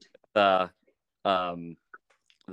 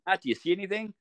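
retold yell; 10 semitones higher, faster than usual. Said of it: "I yell at Matt".

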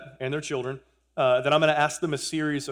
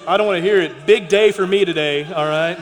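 playful loud protest; 5 semitones higher, 12 dB louder than usual. Real, not acted. Joking about football rivals.

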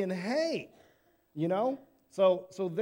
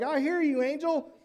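higher and louder, shaken shepherd; terrified shepherd; 8 semitones higher, 5 dB louder than usual. Pretend. Shepherd answering the angel, loud and frightened. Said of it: "Like, I'd be terrified still".

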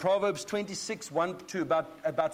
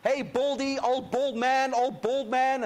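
higher, mocking kids' jeer; higher sing-song jeer at the bald prophet; mock youngsters taunting; 6 semitones higher, 4 dB louder, slower than usual. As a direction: taunt him like cheeky boys. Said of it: "these youngsters start shouting".

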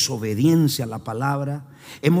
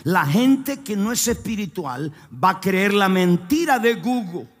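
fiery preaching; 5 semitones higher than usual. Acted. Preacher rallies the standing congregation, shouting. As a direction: preach it full force, calling the church up. Preaching at full force with music.

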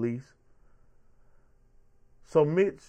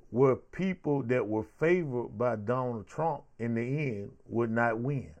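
ordinary delivery

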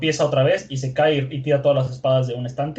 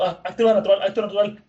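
higher, mildly panicky thought; nervous self-coaching; 5 semitones higher, faster than usual. Retold or imitated. Vendor steadying himself at sight of the sword, nervous. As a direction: coach yourself through mild panic. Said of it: "todo nervioso, eh, dice, pensando".

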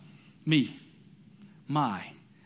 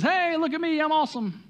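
higher, preening show-off; 13 semitones higher, 3 dB louder than usual. Pretend. Preacher mimicking a loud boaster, higher preening show-off voice. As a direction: brag like you're the best thing around.

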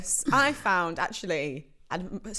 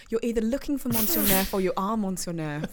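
ordinary delivery

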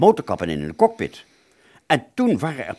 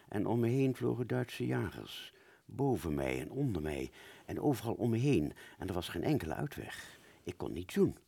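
hushed narration; 2 semitones lower, 9 dB quieter than usual. A narrator's reading. Dreamy fading narration.

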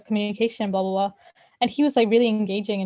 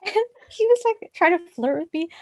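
laughing; 8 semitones higher than usual. Real, not acted. Laughing while retelling flirt.

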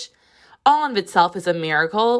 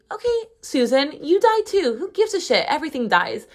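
high, sassy comeback; 6 semitones higher than usual. Real, not acted. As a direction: snap back with mock outrage. Sassy retort to imagined complainer.

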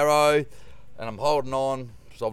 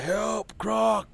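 retold shout for help; 10 semitones higher than usual. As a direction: shout it across the water. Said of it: "And I was yelling out".

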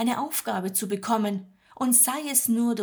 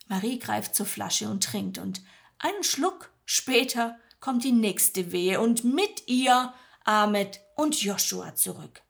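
usual speaking voice

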